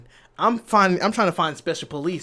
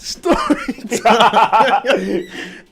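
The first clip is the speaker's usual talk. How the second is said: high, loud, stretched-out call breaking into laughter; hammed-up intro holler; drawn-out intro shout; 5 semitones higher, 6 dB louder, much slower than usual. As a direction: belt out the show title, dragging it out.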